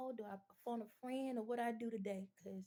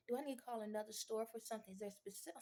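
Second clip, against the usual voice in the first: usual speaking voice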